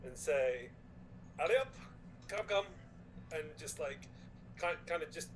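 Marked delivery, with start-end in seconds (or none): 1.4-2.6 lightly acted urging, higher pitched; a mild in-character hurry-up; urging Croesus. Player voices Croesus urging injured Precious along, lightly urgent. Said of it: "Croesus will kind of ... and say".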